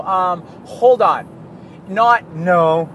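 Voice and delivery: a lightly voiced kid pausing to calculate; niece working it out. Eight-year-old niece slowly working out four times twenty-five aloud. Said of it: "She'll go".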